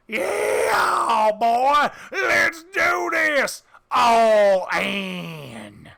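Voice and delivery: high, loud cowboy shout; Texan cowboy yell. Acted. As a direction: hoot it out like a cocky Texan gambler. GM voices Texan going all in.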